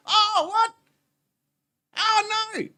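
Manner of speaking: very high falsetto dismay; hammed-up squeaky disbelief; mock shock. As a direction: act appalled in a silly high voice. Mock shock at stolen sign.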